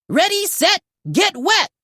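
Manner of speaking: high, punchy rally cry; eager cheer. Acted. Zuma cheering to the team before the rescue. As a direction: shout it like a catchphrase. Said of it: "Zuma barked".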